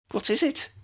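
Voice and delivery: put-on girl's voice. A narrator's reading. Lydia voiced by the reader.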